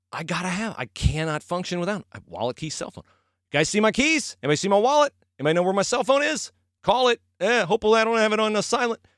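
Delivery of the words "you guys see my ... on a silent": mock panicked search. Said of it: "panicked search for lost keys".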